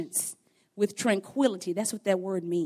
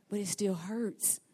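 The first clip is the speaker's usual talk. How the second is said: soft, slow, subdued delivery; subdued admission; 6 dB quieter than usual. Acted. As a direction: drop softer and draw out the hurt. Repeats the line for effect.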